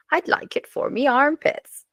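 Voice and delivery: put-on high, sing-song comic Swedish accent. Swedish customer delivering the joke's punchline, high comic Swedish accent. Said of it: "thinking about accents".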